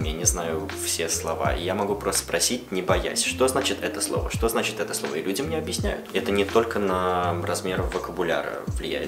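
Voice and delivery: normal talking voice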